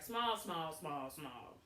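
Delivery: small, small, small sinking to a murmur; fading repeats. Real, not acted. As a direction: let the repeated small trail away low. Stresses how thin he was.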